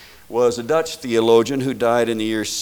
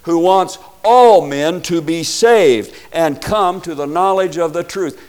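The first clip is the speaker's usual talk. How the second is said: emphatic scripture reading; 6 semitones higher, 9 dB louder than usual. Real, not acted. Preacher reads First Timothy to the congregation, loud and emphatic. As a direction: proclaim it broadly, leaning hard on "all". Quotes scripture at full force.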